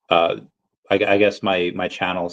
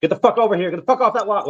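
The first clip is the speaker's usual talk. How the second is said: high-pitched mock scolding; no-nonsense boy mom; 12 semitones higher than usual. Mom calling her boys over, mock scolding. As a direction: imitate a mom ordering her boys around. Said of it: "having kids, especially boys".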